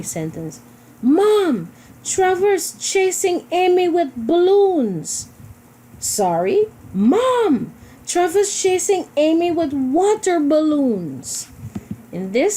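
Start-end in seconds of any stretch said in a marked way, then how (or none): 1.0-5.3 high, loud tattling cry to Mom; excited calling out. Shown as a demonstration. 6.0-11.5 high, loud tattling cry to Mom; excited calling out. Shown as a demonstration. Child correcting, tattling again.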